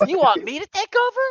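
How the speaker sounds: high silly voice. Pretend. Playful high voice bit.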